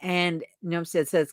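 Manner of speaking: usual speaking voice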